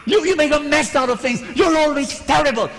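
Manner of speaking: imitated angry rant. Friend mirroring the controlling friend's rant, hammed-up. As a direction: mirror her rant back exactly. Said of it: "the exact tone of voice, the exact volume".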